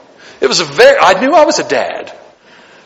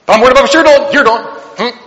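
a busybody prying into someone else's life; nosy busybody voice; 5 semitones higher than usual. Acted. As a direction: play the nosy neighbour prying. Mocking a nosy church member.

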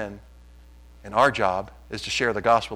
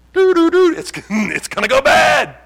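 mock doom singsong; 13 semitones higher, 9 dB louder than usual. Mock doom singsong about markets.

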